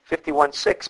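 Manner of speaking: ordinary talk, nothing marked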